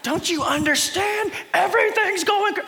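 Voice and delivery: frantic, high-pitched mock alarm; hammed-up desperate cry; freaking out. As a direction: play a panicker pleading to be understood. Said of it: "all the people that are freaking out".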